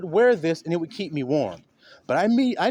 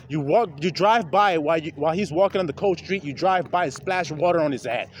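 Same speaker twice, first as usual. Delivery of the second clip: normal talking voice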